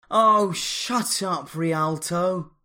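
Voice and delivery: loud high snap, the first words slow; snapping. A narrator's reading. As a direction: cut him off sharply. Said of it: "snapped the woman in purple".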